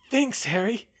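lightly breathless, high and loud; a little out of breath. A narrator's reading. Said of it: "she said breathlessly".

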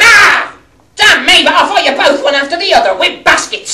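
shouted high and loud, fast; furious challenge. Acted. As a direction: roar out a reckless challenge to fight. Tony challenges both men to fight.

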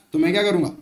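usual speaking voice

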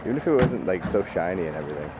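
ordinary talk, nothing marked

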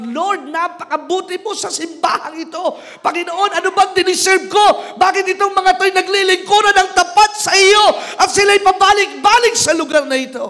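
impassioned high, loud cry to the Lord; shouting aloud to God. Acted. Preacher showing church the urge to praise, shouting aloud. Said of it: "It makes you wanna shout aloud".

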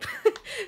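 high giggle of delight; giddy high laugh. Real, not acted. Fan giggling over Rune, high and giddy. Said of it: "I'm done fangirling".

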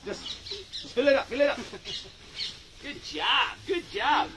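sweet praise for a little one; soft coaxing. Real, not acted. Coaxing child softly.

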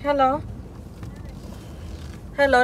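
ordinary delivery

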